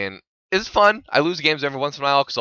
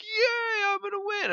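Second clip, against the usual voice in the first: high squeaky cheer, much higher; mock excited; 5 dB quieter, slower than usual. Gamer imitating his own giddy cheer after a great pass. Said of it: "I'll be like".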